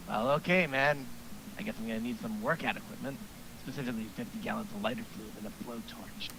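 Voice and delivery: deadpan mumble of a firebug. Acted. Damien voice continues.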